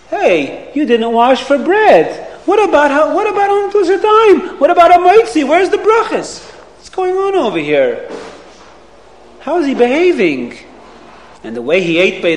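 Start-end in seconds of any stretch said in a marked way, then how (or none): 0.1-10.6 put-on a parent scandalized at the boy skipping brachas; a mommy shocked that her son didn't wash for bread; shocked scolding.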